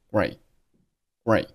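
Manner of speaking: great with the t swallowed, no release; unreleased final t. Shown as a demonstration. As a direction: close on the t of great without letting it pop. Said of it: "但是把声音给吞掉".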